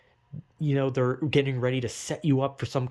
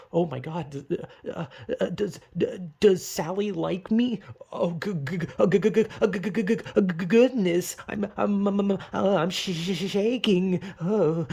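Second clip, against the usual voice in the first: higher, stammering g-g-g and sh-sh, jittery; stuttering nervous wreck; 5 semitones higher than usual. Retold or imitated. Hint receiver asking if Sally likes him, higher jittery stammering. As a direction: stammer like a crush too flustered to speak.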